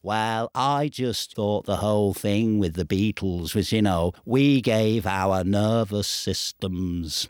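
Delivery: low, slow Liverpool musing. Acted. Impression of [name].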